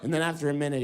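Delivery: ordinary talk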